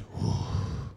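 heavy slow exhales, imitating silent rage; wordless seething breaths. Comedian mimics a beer-soaked man refusing to speak, heavy breathing. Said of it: "and he just went".

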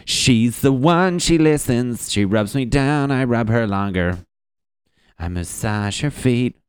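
swooning dude. Retold or imitated. Friend twirling and dancing about his fiancée, lovesick gushing. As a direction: gush dreamily while twirling. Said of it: "he's like".

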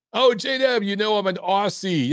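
a hammed-up Aussie twang voicing the viewer; bad Aussie accent. Host voicing Australian viewer's chat message in bad Aussie accent. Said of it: "my accent's horrible".

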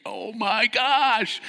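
high slow disbelief; can't believe it; exasperated. Retold or imitated. Exasperated rafters.

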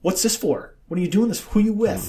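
retold string of pushy questions; confrontational. Man interrogates interviewer on camera, mock confrontational. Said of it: "confrontational, yeah".

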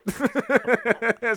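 high run of chuckles; laughter. Real, not acted. Host amused by skipping the show's description, giggling. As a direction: giggle at your own remark.

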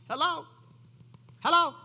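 high, loud "hello?"; mock wake-up call. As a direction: call out as if nobody's home. Mock challenge to praying people.

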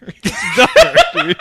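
laughing it out, slowed down; cracking up while insisting. Real, not acted. Laughing as he tells his cohost how dumb the twist is.

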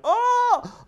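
very high, loud yelp of alarm; panicked yelp. Retold or imitated. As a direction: yelp as if thrown off balance. Bill as urine sloshes, kangarooing.

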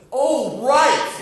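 high, loud startled yelp; sudden realization. Retold or imitated. Counselor realizing his camper just jumped in the lake, startled yell. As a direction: snap awake to the danger all at once.

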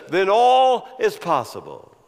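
"if Jesus" hammered high and loud, building to the end; preaching at full force. Acted. Preacher building the congregation to hope, rising preaching voice. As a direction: climb with each if, then land it.

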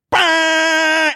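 high loud honk imitation; car horn blare. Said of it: "it would just be like".

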